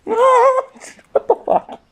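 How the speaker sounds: very high, very slow squeak; squeaky shocked critter. Acted. Voicing a photo creature reacting, very high and slow. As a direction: drag the squeak out in disbelief. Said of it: "try and make voices for stuff".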